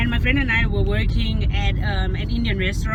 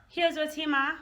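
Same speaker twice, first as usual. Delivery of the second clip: ordinary talk, nothing marked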